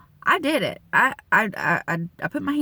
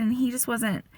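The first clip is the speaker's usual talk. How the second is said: ordinary talk, nothing marked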